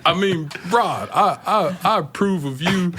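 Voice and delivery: high, laughing impression of the clueless boss; mock boss voice. Mocking his old plant manager, high laughing boss voice. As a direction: squeak out his awkward approval through laughter.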